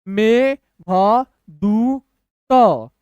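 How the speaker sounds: very slow, long gaps between sounds; syllable by syllable. Shown as a demonstration. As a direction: give each letter its own beat. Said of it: "तोड़ तोड़ के पढ़िए".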